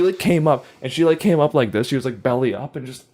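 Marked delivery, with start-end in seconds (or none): none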